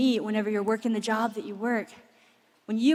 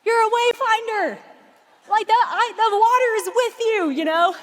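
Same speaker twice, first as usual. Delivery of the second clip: excited encouraging dad; 8 semitones higher than usual. Retold or imitated. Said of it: "I'm like".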